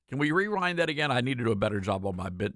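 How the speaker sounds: ordinary talk, nothing marked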